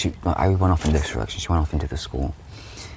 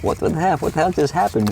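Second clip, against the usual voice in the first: much higher, bewildered cry of hurt confusion; stunned disbelief; 11 semitones higher than usual. Retold or imitated. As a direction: squeak it out like a stung kid. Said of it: "I'm like".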